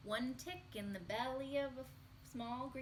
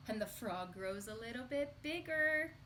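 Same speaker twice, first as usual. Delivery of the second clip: ordinary delivery, nothing marked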